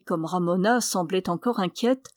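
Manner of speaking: ordinary delivery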